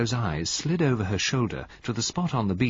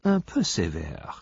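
Poirot voiced, French; 3 semitones lower than usual.

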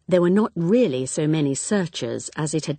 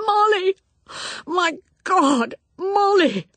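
high, loud, crying out her name; anguished cry; 4 dB louder, slower than usual. A narrator's reading. Husband finding his drowned wife, anguished loud cry. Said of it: "she heard his voice cry out".

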